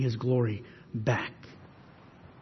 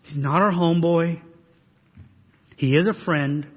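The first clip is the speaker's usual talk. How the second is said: ordinary talk, nothing marked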